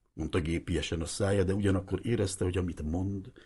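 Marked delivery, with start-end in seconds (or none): none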